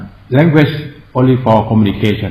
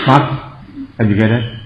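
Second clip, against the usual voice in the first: ordinary talk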